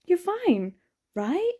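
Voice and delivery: high, sing-song comforting; mock reassuring. The bonus story soothing the heartbroken reader, mock reassuring. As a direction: coo it like soothing a child. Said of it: "to make you feel like".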